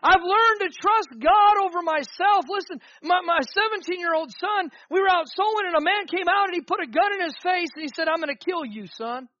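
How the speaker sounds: loud, high preaching climax; full-force preaching. Real, not acted.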